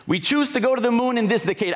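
put-on trying on a Boston accent and giving up; attempted Boston accent. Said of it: "I don't know how to do the Boston accent".

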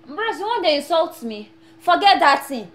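loud, high indignant protest; offended outburst. Acted. Immigrant shouting back at his host for insulting him.

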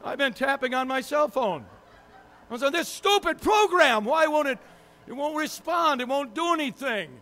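loud, high, exasperated ranting at the phone; frustrated outburst. Retold or imitated. Frantic with frozen phone, frustrated.

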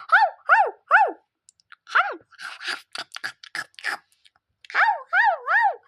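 high, loud yapping barks; dog barking. Acted. Child playing the dog Sofiş barks high and loud. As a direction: bark like a small dog.

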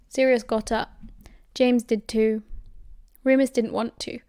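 ordinary delivery, nothing marked

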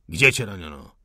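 loud, forceful question; stern interrogation. A narrator's reading. Officer demands where the insurgent was wounded, loudly. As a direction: press the prisoner hard.

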